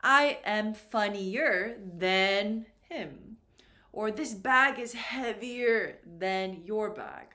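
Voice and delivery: each word spaced out, slow and clear for learners; slow model sentence. Shown as a demonstration. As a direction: say it slowly so the class can follow. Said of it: "remember the important word was than".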